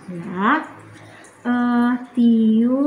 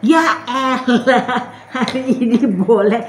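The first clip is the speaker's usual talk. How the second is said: laughing; 4 dB louder, slower than usual. Real, not acted. Laughing giving in.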